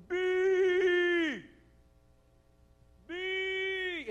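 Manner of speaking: high, long calling cry across a battlefield; a king summons his champion from afar. Retold or imitated. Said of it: "the king goes".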